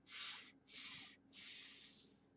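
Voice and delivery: long unvoiced S hiss; snake hiss sound. Shown as a demonstration. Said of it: "Can you make the sound of S".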